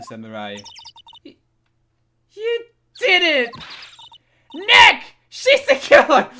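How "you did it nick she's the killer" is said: triumphant shout. Acted. Voicing triumphant Maya.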